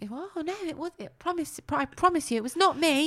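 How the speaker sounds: high, pleading little toy voice; put-on high voice, insisting; Noddy's girlish voice. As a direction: protest innocence in a high girlish voice. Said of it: "it's got a girl's voice".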